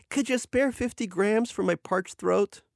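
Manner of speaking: high, slow request; drawn-out pleading character voice; wheedling Oleg. A narrator's reading.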